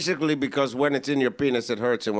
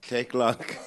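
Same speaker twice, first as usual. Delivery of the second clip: Brian Regan impression; 4 dB quieter than usual. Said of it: "It's a Brian Regan joke".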